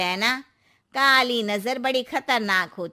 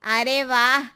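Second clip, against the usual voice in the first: ordinary talk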